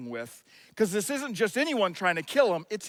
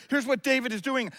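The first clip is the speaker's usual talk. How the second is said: ordinary talk, nothing marked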